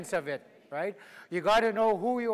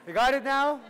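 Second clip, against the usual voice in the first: normal talking voice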